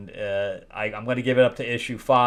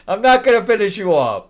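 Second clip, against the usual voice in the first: higher, louder mocking imitation; mocking mimicry; 9 semitones higher, 7 dB louder than usual. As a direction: parrot her line in disbelief. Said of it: "What? What?".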